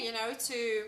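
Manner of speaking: mind going blank mid-sentence; stalling, searching for the next word; brain freeze. Real, not acted. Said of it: "I'm getting a brain freeze".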